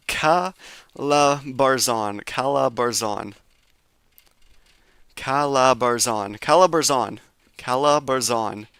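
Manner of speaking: "Calabarzon" drawn out syllable by syllable; "Calabarzon" said very slowly to practise it; slow careful practice. Real, not acted. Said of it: "make sure I'm doing that right".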